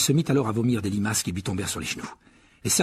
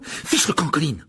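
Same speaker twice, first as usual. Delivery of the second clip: angry; 6 semitones higher, 4 dB louder than usual. A narrator's reading. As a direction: cry out angrily, high and breathy. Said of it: "s'écria Harry avec colère". (cri de colère)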